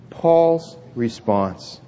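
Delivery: slow, drawn-out phrase for the congregation to echo; slow lead-in. Shown as a demonstration. Pastor leading the church to repeat, slowly. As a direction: stretch each word so they can repeat it. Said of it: "Let's say that together".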